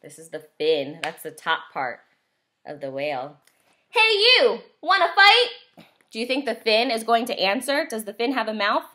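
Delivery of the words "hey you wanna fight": high, loud picking a fight; cocky little bug calling out; grouchy ladybug. A narrator's reading. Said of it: "the grouchy ladybug said".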